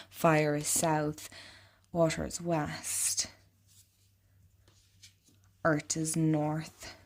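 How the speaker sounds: ordinary delivery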